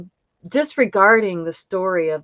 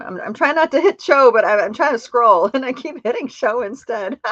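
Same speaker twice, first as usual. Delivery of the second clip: higher and louder, laughing through the words; flustered laughing; 6 semitones higher, 6 dB louder than usual. Real, not acted. Host fumbling the scroll live, laughing and flustered. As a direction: giggle while fumbling with the screen. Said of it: "I don't usually talk like this".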